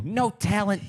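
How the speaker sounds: high, sneering mock put-down; imitating Douglas. Imitating Douglas Levison's catchphrase to listeners, sneering. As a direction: toss off a snobby "No talent". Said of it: "you might occasionally hear me or Kendra go".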